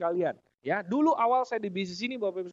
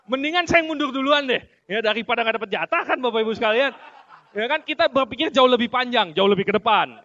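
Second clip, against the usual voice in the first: louder, laughing through the words; loud laughing talk; 7 dB louder than usual. Real, not acted. Speaker tells the seminar why he backs off from his wife, laughing loudly. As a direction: laugh while you explain the joke. Joking about backing down to wife.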